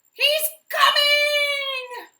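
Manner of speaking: very high, loud, slow shriek. A narrator's reading. Mouse shrieks to the hiding animals. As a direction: scream the alarm. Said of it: "shrieked the mouse".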